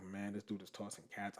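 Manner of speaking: very soft retold aside; uneasy inner thought. Guest thinking to himself about the cat tossing, hushed and uneasy. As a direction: drop the volume right down, as if thinking.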